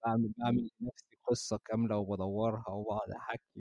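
normal talking voice

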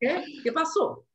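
high, startled what, in Spanish; confused what. Retold or imitated. Storyteller to the mugger, startled and high. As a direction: pitch it up in bewildered surprise. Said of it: "I was like".

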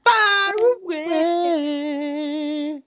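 very slow, high goofy voice; drawn-out high voice. Acted. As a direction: stretch every sound out. Segment bit voice.

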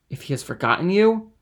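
high, loud bitter question; bitter challenge. A narrator's reading. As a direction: throw the question at her. Fernand presses hard.

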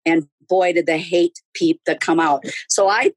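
ordinary talk, nothing marked

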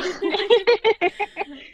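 a bright burst of laughter; high laugh after the story. Real, not acted. Laughs after the retold reply.

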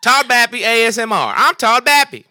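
high, loud put-on character voice; Todd Bappy voice. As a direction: shout it in a silly high voice. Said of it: "as Todd Ba".

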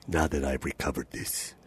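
low, slow, whispered threat; sinister villain whisper. Pretend. Auditioning the villain line for the producer, whispered low and slow. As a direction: whisper it like a doom-bringing villain. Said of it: "say something like".

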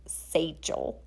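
put-on "satchel" with a soft Wisconsin mom accent, first vowel stretched to "say"; nerdy Wisconsin mom accent. Sibling mimicking mom's Wisconsin accent on "satchel". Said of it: "in a nerdy Wisconsin mom accent".

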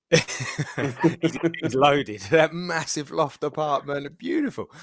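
laughing and high, slow on the loft; laughing envy. Real, not acted. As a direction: marvel at the loft through a laugh. Envying the loft flat.

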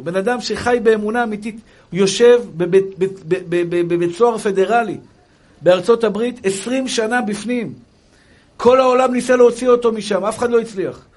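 high, loud, fervent sermon; impassioned preaching. Real, not acted. Rabbi urges faith amid hardship, impassioned preaching.

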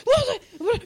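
high, loud startled yelp; jumpy yelp. Retold or imitated. Player imitating being on edge near alligators, jumpy yelp. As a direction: yelp as if spooked.

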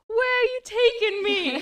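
high, loud, slow startled squeal. Retold or imitated. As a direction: squeal it like she's been swept up. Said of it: "she's like".

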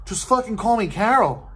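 mock-exasperated just call me Carol. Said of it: "and it's like".

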